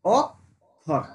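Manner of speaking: অক্ষর as two separate pushes, "ok ... khor"; syllable split. Shown as a demonstration. Teacher repeating the split for pupils, beat by beat. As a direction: pause inside অক্ষর after the k. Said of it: "দুটো হচ্ছে অক্ষর".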